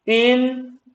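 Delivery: इंटेलिजेंट broken into in, te, li, gent; syllable demo. Shown as a demonstration. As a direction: sound out इंटेलिजेंट part by part for a class. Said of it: "अब जैसे for example देखो".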